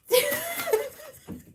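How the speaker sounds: high shrieking laugh at the cat; burst of laughter. Real, not acted. Laughing at her brother's evil cat.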